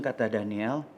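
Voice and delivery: plain reading voice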